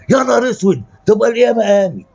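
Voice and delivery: high-pitched exaggerated dismay to flatter; gushing mock horror. زوج يبالغ في تدليل زوجته. (تهويل وتدليل بصوت عالي الطبقة)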